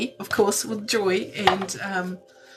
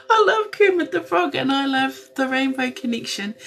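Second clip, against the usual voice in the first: laughing; 5 semitones higher, 5 dB louder than usual. Real, not acted. Laughing about Kermit.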